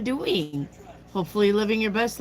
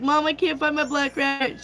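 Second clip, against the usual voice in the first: put-on higher, worried child calling out; young self calling out for mom, higher; calling mom as a kid; 8 semitones higher than usual. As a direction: call for mom like a kid who lost her pet.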